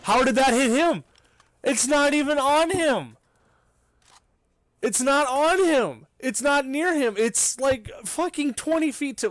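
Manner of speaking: high, loud furious yelling; raging gamer. Real, not acted. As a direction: shout it out in disbelief. Furious at the shot on teammate.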